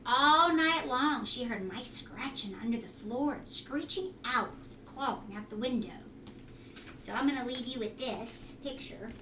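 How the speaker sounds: plain reading voice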